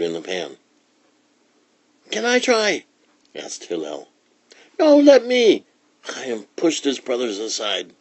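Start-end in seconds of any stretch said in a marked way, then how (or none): 2.1-2.8 put-on high-pitched child voice; piping little-brother request; eager little boy. A narrator's reading. Little brother begs a turn. 4.8-5.6 put-on high, loud child voice; insistent, piping little brother; pushy little boy. A narrator's reading. Pushy brother wants a turn.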